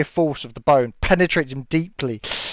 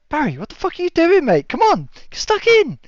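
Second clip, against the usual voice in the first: much higher, goading mates' voice; egging-on mates; 13 semitones higher than usual. Acted. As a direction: urge Barry back into the fight. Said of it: "the other four behind him are like".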